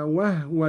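وهو said "wahwa"; silent-vowel ha. Shown as a demonstration. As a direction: stop the first syllable of وهو on a vowelless ه. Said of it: "وكذلك قوله تعالى".

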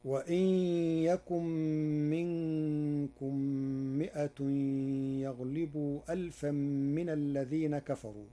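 chanting Quranic verses; Quran recitation. Acted.

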